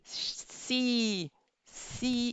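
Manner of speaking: "C" said with a wide smile, a long clear ee; smiling E sound. Shown as a demonstration. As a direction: stretch the lips wide on "C". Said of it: "you need to make that smiling movement".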